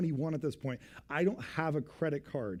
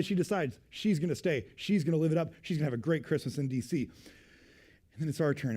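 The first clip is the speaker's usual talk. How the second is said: ordinary talk, nothing marked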